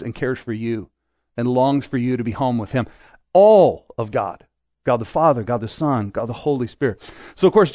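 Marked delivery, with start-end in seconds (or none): 3.3-4.4 ALL held long and falling; slow, weighty stress on every word; slow emphasis. Real, not acted. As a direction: lean on All, then let God land. Emphatic sermon line.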